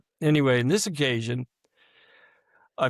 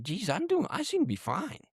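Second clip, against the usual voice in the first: high-pitched, lightly voiced inner thought; retold thought in a raised, softer voice; puzzled self-reassurance; 9 semitones higher, 4 dB quieter than usual. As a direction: pitch it well up, as if reasoning with yourself. Retold thought, reassuring himself he's fine.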